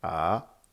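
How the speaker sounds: A rising from mid pitch; "A" lifted like a question; second tone. Shown as a demonstration. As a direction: let "A" climb steadily upward. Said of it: "Avec les quatre tons".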